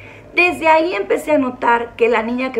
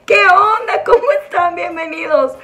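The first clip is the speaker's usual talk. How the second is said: excited greeting; 7 semitones higher, 4 dB louder than usual. Acted. Cheery vlog opening greeting.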